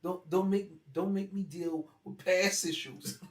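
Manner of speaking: put-on teen warning the old uncle, loud and slow. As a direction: warn him off, drawn out. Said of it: "I said".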